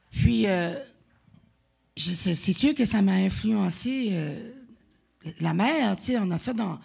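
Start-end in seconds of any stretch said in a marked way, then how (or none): none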